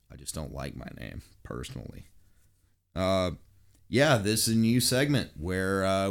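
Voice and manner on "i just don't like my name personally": quiet aside. Real, not acted. Mumbled aside about his name.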